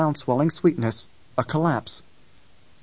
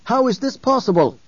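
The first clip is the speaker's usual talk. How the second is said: loud, higher furious demand; raging; 10 semitones higher, 4 dB louder than usual. A narrator's reading. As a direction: rage at them for the escape. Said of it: "Halleck raged".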